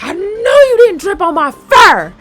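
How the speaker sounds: slow, high, loud outrage; mock-furious shout. Freshly washed kitty confronts the kidnapper, hammed-up fury. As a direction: belt it out like an enraged cartoon cat. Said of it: "So she got mad".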